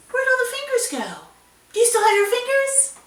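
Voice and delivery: high gasping mock alarm; mock surprise. Teacher pretends fingers vanished, high mock gasp.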